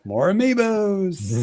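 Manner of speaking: high, drawn-out gleeful cheer; mock excitement. Announcing merchandise to co-hosts, mock excited. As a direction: stretch it out like a hammed-up hooray.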